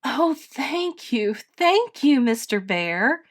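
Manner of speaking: put-on high, overjoyed child's thanks; high-pitched, effusive thanks; grateful cry. A narrator's reading. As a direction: gush with relief. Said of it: "cried Edith".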